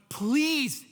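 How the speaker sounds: high, loud burst on the first word; imitated desperate child; mock screamed plea. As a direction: beg a stranger, hammed up. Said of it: "I'm screaming at a stranger".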